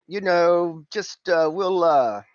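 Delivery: lightly voiced cockney fixer; Simon's cockney voice. GM as Simon pitching the job, lightly cockney. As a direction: haggle in a cocky cockney lilt.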